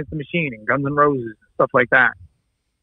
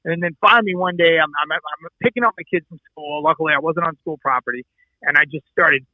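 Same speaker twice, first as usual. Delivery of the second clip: normal talking voice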